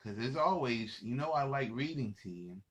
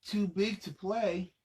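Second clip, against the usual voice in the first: sing-song refrain; 7 semitones higher, slower than usual. Acted. Repeated refrain read to kids.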